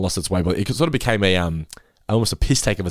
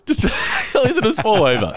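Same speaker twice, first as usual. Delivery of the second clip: usual speaking voice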